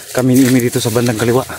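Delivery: ordinary delivery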